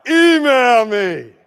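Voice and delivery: slow, loud, curt; slow loud sign-like brush-off; rude brush-off. Shown as a demonstration. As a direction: say it like a posted sign. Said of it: "That's a little rude".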